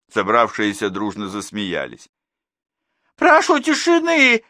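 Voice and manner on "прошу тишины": very high, loud, slow squeal for silence; shrill squeal. A narrator's reading. Class teacher squeals at the laughing parents for silence. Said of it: "взвизгнула классная руководительница".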